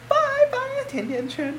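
strained singing that breaks; voice cracking. Real, not acted. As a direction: voice cracks high on the sung line. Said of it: "我直接大破音了".

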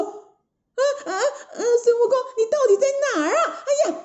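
high, panicky, rapid questioning; flustered, high-pitched storybook villainess; startled princess. Acted. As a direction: gasp and ask in acted fright. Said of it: "铁扇公主吓了一跳".

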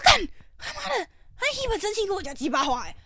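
very high, far-off cry of disbelief; mock outraged yell. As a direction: explode at the nonsense in a put-on high voice. Retold outrage at the excuse. (极高音的夸张惊怒)

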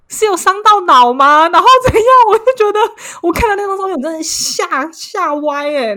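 loud, high-pitched panic; retold fright. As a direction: relive the shock, starting very loud and easing off.

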